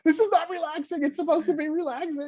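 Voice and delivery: very high, creeped-out squeal; high-pitched playful revulsion; squeamish mock panic. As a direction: squirm through it in a high, hammed-up fret. Mock squirming at creepy ASMR sounds.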